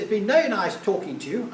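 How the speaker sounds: lightly imitated posh English sign-off. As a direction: politely end the call in a posh voice. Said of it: "this very posh voice said".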